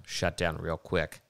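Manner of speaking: ordinary talk, nothing marked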